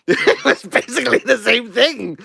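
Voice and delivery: laughing through the line; giggling as you say it. Real, not acted. Laughing repetition.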